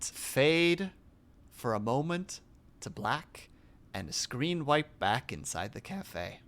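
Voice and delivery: mock TV scene narration; deadpan stage directions. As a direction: read the cues like a dry narrator. Spoken stage directions for the skit.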